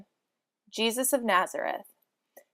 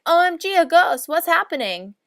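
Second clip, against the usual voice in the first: startled disciples; 5 semitones higher, 4 dB louder than usual. Acted. Disciples see a ghost.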